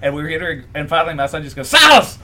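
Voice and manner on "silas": a high, loud yelled name; retold scream. Son shouts his own name at the actor, high loud yell. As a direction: shout the name across the room like a kid. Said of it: "He screamed his name at him".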